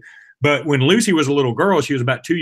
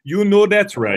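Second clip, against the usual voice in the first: put-on French accent; 5 semitones higher than usual. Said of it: "I don't even know if that's a good French accent".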